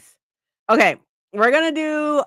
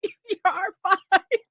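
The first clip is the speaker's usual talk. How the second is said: laughing reassurance; 8 semitones higher than usual. Retold or imitated. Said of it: "And I'm like".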